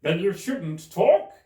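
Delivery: high, loud, slow put-down; rude Hatter. Acted. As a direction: snub her loudly and slowly.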